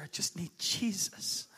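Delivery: slow whispered plea; whispered longing. Acted. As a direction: breathe it out slowly. Voicing a hungry seeker.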